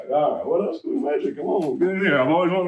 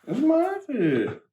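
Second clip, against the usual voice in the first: exaggerated cartoon intonation; 9 semitones higher than usual. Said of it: "why are you talking like this?".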